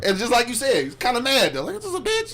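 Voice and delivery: worked-up, high-pitched disbelief. Real, not acted. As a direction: voice climbing high with emotion. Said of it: "Voice, voice got all high pitched".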